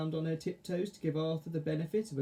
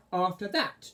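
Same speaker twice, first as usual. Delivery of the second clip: chortling witch; 5 semitones higher, 3 dB louder than usual. Acted. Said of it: "chortled Edna".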